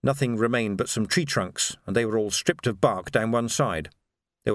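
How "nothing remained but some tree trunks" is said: plain reading voice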